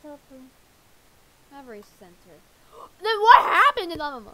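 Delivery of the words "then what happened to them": shocked gasp. Acted. Gasp at doctor's news.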